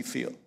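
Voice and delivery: "feel-" for "fulfilled", first vowel said as a long ee; Southern drawl turns "feel-" into a clipped ee vowel; southern slip. Real, not acted. Pastor reading scripture slips into a Southern "feel-" with a long ee. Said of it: "my southern accent coming out right there".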